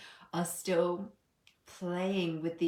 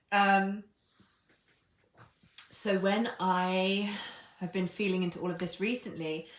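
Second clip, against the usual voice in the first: ordinary talk, nothing marked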